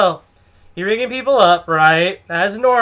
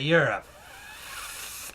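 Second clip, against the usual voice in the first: cut-off insult; 5 semitones lower, 12 dB quieter, much slower than usual. Retold or imitated. Customer insulting the cashier, word dissolving into air. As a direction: trail into a long airy fff. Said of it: "that's all she said".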